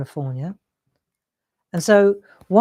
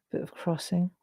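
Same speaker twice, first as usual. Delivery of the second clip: whisper; 10 dB quieter than usual. Real, not acted. Murmuring while shading.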